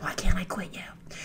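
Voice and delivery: breathy whispered plea, voice almost gone; mock whisper. Mock-lovesick Rey whispering to Kylo. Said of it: "She's like".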